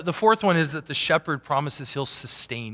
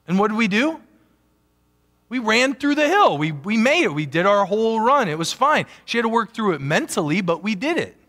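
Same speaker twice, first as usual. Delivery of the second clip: higher and louder, proud of the climb; triumphant; 7 semitones higher, 4 dB louder than usual. Real, not acted. Preacher telling the congregation they made it, raised and higher. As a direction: celebrate the finish with rising energy. Triumphant end of the run story.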